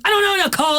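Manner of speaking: high-pitched imitation of a reply; mock exasperated reply. Friend answers her hitchhiking question, imitated high-pitched.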